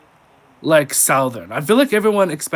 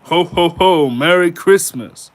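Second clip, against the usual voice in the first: loud, higher jolly Santa, slow on the greeting; 5 semitones higher, 11 dB louder, slower than usual. Pretend. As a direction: boom it out like Santa. Santa impression.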